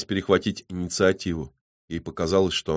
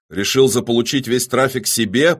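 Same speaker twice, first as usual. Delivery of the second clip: higher, louder demand; growled accusation; 5 semitones higher, 7 dB louder than usual. A narrator's reading. Killer accuses his rival of taking the traffic, growling. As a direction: accuse him angrily, rising at the end. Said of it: "прорычал убийца".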